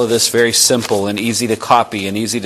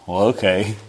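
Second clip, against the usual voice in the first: reluctant okay; 2 semitones lower, 4 dB quieter than usual. Retold or imitated. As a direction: shrug it off like a doubtful stranger. Reluctant listener agrees.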